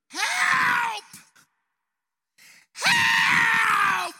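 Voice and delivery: screamed very high and loud, stretched out; desperate cry for help. Retold or imitated. Lost boy calling across the woods, desperate high scream. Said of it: "A desperate cry of help".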